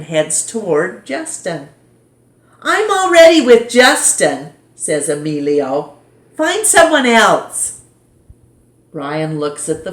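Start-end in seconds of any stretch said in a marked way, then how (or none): 2.6-4.5 much higher, loud, dismissive; snubbing boy. Acted. Emilio rebuffs Brian. 6.4-7.8 much higher, loud, dismissive; snubbing boy. Acted. Emilio dismisses Brian.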